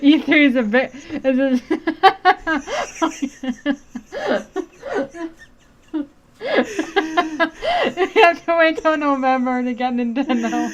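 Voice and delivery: high and breathless with laughter; laughing. Real, not acted. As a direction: wheeze it out while cracking up. Laughing at a date mix-up.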